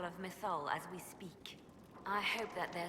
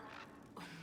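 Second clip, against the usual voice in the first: quiet laugh; 12 dB quieter than usual. Real, not acted. Short laugh amid the talk.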